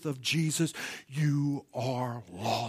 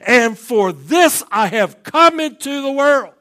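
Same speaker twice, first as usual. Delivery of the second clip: full-force preaching; 11 semitones higher, 22 dB louder than usual. Acted. Preacher proclaiming Jesus' kingship to the church, full-force preaching. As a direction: thunder the verse out to the congregation.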